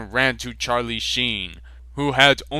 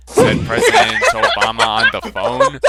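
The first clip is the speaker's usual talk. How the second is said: laughing; 7 dB louder than usual. Real, not acted. Reader laughs mid-line.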